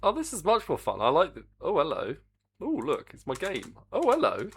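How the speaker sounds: high, swooping pleased greeting; delighted surprise. Real, not acted. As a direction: coo over it in a high, lilting voice. Delighted as the game appears.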